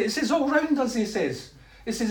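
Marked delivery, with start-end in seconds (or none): 0.3-0.9 high-pitched wonder; retold amazement, pitched up; awestruck. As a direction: marvel at being surrounded. Retold man describes vision.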